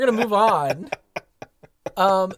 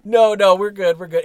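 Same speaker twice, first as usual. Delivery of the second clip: loud reassurance; 3 semitones higher, 10 dB louder than usual. Real, not acted. Hurried reassurance after the rant.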